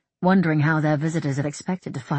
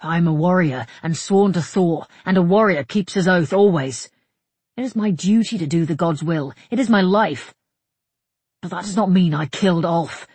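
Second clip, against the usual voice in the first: loud, emphatic oath-bound soldier; proud, insistent defence; irked warrior; 2 semitones higher, 7 dB louder than usual. Acted. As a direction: protest with raised voice and conviction.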